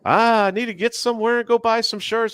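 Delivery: high, whiny, put-on beggar voice; sky-high mock voice, hinting for handouts. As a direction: whine for free shirts like a shameless e-beggar. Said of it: "I've seen some other ones out here going".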